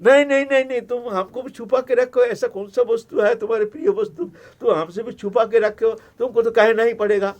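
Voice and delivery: loud, high insistence; wife nagging her husband to tell; insistent Parvati. Acted. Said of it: "आप देवी पीछे पड़ गए". (ज़िद करती पार्वती, ऊँची और तेज़ आवाज़)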